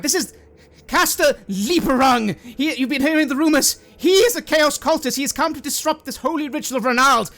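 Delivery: high, loud cult leader rallying the faithful; calling out to the crowd. Acted. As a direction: proclaim it to the brothers and sisters. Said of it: "just calls out".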